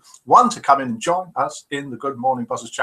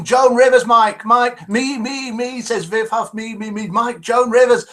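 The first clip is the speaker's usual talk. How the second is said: higher-pitched, eager mock calling out; imitated shouting out; 7 semitones higher, 4 dB louder, slower than usual. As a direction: call out like a keen pupil, voice raised high. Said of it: "Viv Huff is shouting out".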